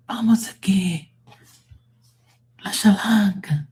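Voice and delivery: high airy whisper, teasing; whispered "Vamos aquí", high and unvoiced; playful whisper. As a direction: breathe the words like a secret joke. Playful whispered foreign phrases.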